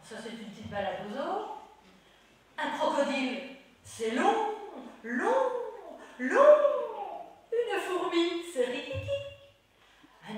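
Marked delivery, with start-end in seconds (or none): 2.6-4.1 put-on high, loud singsong for children; reciting a nursery rhyme. Performs a gesture rhyme. 4.1-6.5 each long higher and louder; rising repetition. Acted. Rhyme rising on repeats.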